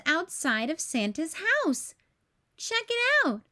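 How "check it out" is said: excited BJ. A narrator's reading. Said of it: "said B J".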